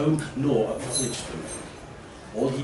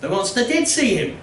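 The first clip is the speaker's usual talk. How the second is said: much higher, louder insistent villager; 11 semitones higher, 5 dB louder than usual. Acted. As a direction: protest that the monster saw him.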